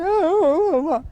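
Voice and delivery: high mock whimper of a fidgety child; a hammed-up kid's whimper; imitating child whimpering. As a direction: whine like a little kid near tears. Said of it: "kinda crying".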